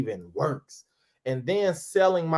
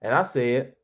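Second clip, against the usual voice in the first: ordinary talk, nothing marked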